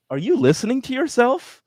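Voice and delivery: high-pitched exasperated scolding; mock incredulous rebuke. Acted incredulous reply to euphemism users.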